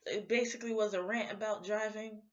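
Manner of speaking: ordinary talk, nothing marked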